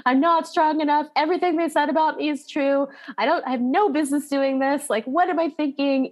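a hint of tearful defeat, no real crying; retold despair. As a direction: relive the breakdown in a light mock wail. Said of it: "sobbed, hunched over and was like".

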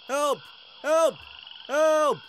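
short, high, loud cries of fear; panicked high-pitched yelps; frightened screaming. A narrator's reading. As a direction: scream for help, full of fear. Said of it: "schreeuwen ze vol angst".

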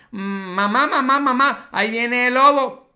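retold yell. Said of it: "le vuelve a gritar más tarde el niño".

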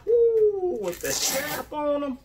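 high excited woo; excited whoop. Real, not acted. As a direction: whoop with joy at the shoes. Excited shoe reveal.

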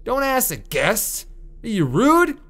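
high, slow mock-indignant complaining; indignant guest. Guest scolds the hotel owner, high slow mock indignation. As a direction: play the offended hotel guest. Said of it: "I'd be like".

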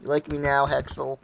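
ordinary talk

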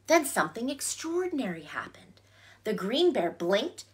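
hushed storytelling whisper. Acted. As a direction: whisper it as a secret. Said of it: "storyteller whispers for suspense".

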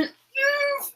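sudden high-pitched oof, like a sharp sting. Real, not acted. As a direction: yelp a startled oof up high. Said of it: "Yes, I said oof".

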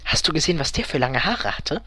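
hushed, scandalised question; shocked. A narrator's reading. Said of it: "fragte Elfriede schockiert".

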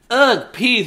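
put-on high disgusted girl voice; disgusted kid. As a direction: say it grossed out. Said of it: "she's going".

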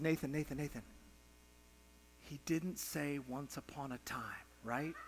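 very soft, low, careful question; retold tender father's murmur; gentle coaxing. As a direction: hush right down to ask a crying child. Father gently asks his crying son.

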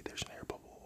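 ordinary delivery

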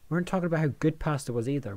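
usual speaking voice

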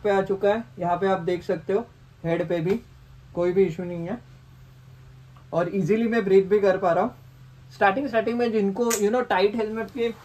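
muffled inside full-face helmet; speaking through covered mouth; through a balaclava and helmet. Real, not acted.

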